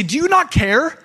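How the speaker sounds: high, loud frightened plea; panicked cry. Retold or imitated. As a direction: cry it out as if the boat is sinking. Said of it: "The disciples say".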